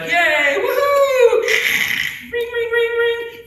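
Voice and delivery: very high squealing woohoo with bell ringing; overjoyed kid shouting yay and ringing bells; mock giddy cheer. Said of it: "He's all excited".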